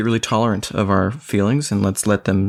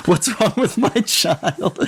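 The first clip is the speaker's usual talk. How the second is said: high, slow, through laughter; laughing mock dismay; 12 semitones higher, slower than usual. Father reacting to his son's demon act, laughing.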